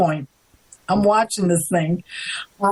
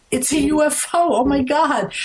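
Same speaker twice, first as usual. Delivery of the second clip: retold scream; 10 semitones higher than usual. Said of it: "And I'm screaming".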